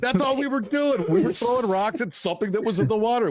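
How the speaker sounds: high, laughing protest; amused high voice. Real, not acted. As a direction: insist through laughter. Laughing defense of the rock throwing.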